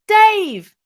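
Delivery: high, loud call across the room. Retold or imitated. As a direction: shout his name to stop him. Calling out after [name].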